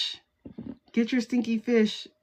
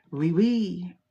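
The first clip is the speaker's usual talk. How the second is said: put-on playful French accent, oui oui; tossed-off mock French accent; 2 semitones lower than usual. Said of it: "and I hear French accents".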